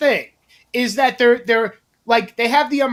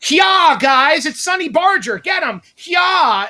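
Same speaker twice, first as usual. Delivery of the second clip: high, shouted mock bravado; mocking protester taunt; 6 semitones higher, 3 dB louder, slower than usual. Mocking protesters calling out a biker boss, high shout. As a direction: ham up a cocky protester egging on the crowd. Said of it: "with your umbrellas".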